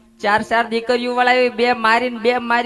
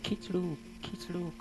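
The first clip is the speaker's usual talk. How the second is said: much softer, dying-away repeats; fading echo; 4 semitones lower, 16 dB quieter, slower than usual. Acted. Echoes own last word, dying away. (ધીમો પડતો જતો પડઘો)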